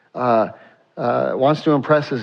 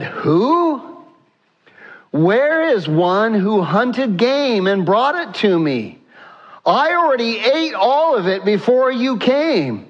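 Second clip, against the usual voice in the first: high-pitched, stunned father; alarmed father; 7 semitones higher than usual. Acted. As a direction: raise the pitch in shocked disbelief. Alarmed Isaac reacts to discovery.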